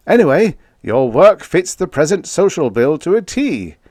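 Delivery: an affable president leaning in; a hint of a genial elderly statesman; President Collins. A narrator's reading. Collins speaks again.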